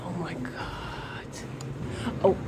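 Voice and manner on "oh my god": slow, breathless whisper of awe; awed whisper. Real, not acted. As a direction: barely breathe it out in wonder.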